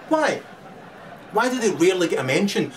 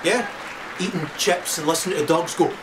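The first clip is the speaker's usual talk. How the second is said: ordinary talk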